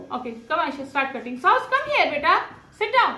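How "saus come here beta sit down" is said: stern calling out. Real, not acted. Mother ordering her child to homework, calling out sternly.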